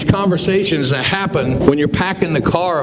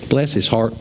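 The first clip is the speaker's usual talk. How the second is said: Southern bless; 6 semitones lower than usual. Retold or imitated. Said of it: "people say in the South".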